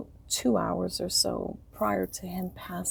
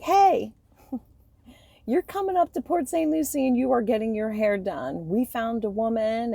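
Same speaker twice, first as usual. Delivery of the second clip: high, loud, bubbly retelling of the friends; imitating two friends hyped about a plan; excited friends; 4 semitones higher, 6 dB louder than usual. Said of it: "were like".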